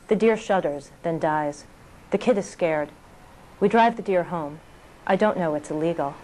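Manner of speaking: normal talking voice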